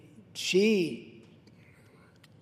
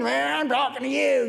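put-on drunk cowboy; 8 semitones higher than usual. Drunk cowboy provoking the Kung Fu hero, hammed-up challenge. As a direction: play a drunk cowboy picking a fight. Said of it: "you know, the drunk cowboy".

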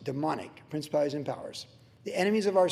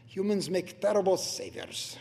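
put-on Russian accent; 8 semitones lower, 6 dB quieter than usual. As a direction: imitate a Russian speaker, deep and deliberate. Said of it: "with his Russian accent".

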